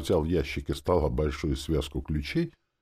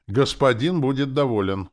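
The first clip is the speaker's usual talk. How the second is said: high, loud assurance with conviction; earnest zombie assurance; 9 semitones higher, 6 dB louder than usual. A narrator's reading. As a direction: assure the master with full conviction. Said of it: "убеждённо просипел зомби Валера". (убеждённо, высоко и громко)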